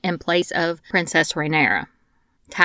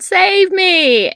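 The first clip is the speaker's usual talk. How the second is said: high, louder mock scream for rescue; retold scream; 12 semitones higher, 5 dB louder than usual. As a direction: cry out like a soldier begging to be saved, hammed up. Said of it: "He screams out".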